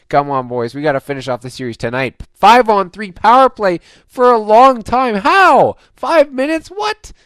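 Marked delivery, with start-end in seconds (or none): 2.3-7.1 high, loud incredulous yelling at the screen; can't believe the call, shout it; rising indignant yells; outraged disbelief. Real, not acted. Outraged at a long penalty call.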